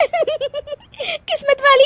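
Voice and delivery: very high echo breaking into laughter; laughing. Acted. Daughter-in-law repeats a guest's compliment, bursting out laughing. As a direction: crack up at the compliment. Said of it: "हंस क्यों रही हो".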